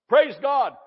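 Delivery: ordinary talk, nothing marked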